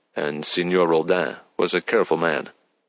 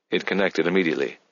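ordinary delivery, nothing marked

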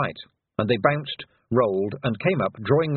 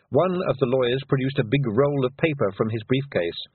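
ordinary delivery, nothing marked

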